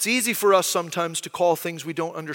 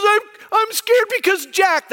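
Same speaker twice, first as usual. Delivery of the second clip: put-on high, slow small-child voice, frightened; imitating his little son, pitched way up and fearful; scared little boy; 12 semitones higher, 4 dB louder than usual. Little son tells his dad why he's scared, high child voice. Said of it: "and he's so scared".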